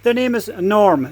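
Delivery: ordinary delivery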